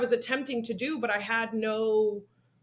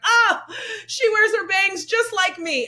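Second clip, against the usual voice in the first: high, slow, mock-doting coo; louder, drawn-out pretend adoration; gushing parent; 8 semitones higher, 5 dB louder, slower than usual. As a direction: imitate a parent swooning over their kid.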